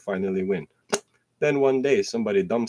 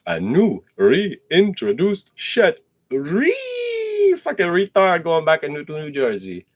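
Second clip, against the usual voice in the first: mock drawn out; 8 semitones higher, 6 dB louder, slower than usual. Stretched mock word.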